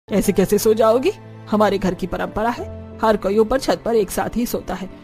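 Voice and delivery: stern mother-in-law. Acted. (हुक्म चलाती सख्त सास)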